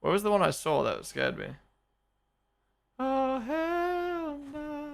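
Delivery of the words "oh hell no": high, very slow drawn-out refusal; mock horrified. Streamer hearing boogeyman story, hammed-up horrified wail. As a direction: stretch it out like a hammed-up scaredy cat. Remembering scary thing.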